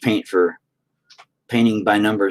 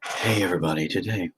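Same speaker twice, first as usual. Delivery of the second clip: soft, low TV painter parody; hushed, gentle painting-show greeting; Rob Boss; 3 semitones lower, 6 dB quieter than usual. Acted. Mock TV painter greeting the viewers, hushed and low. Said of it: "Then I would be Rob Boss".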